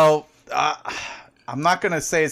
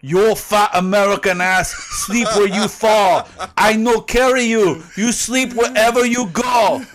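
put-on higher, louder broken English with a Japanese accent; mock Japanese accent; 6 semitones higher, 4 dB louder than usual. Japanese friend scolding the drunk American, in a Japanese accent. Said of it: "the same advice that was given to me".

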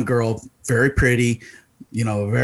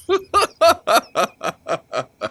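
high mock laugh; 12 semitones higher than usual. Retold celebrity laughing.

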